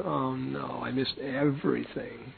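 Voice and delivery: soft, slow groan of dismay; mock despair. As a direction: lament missing everything. Despair about missed feast.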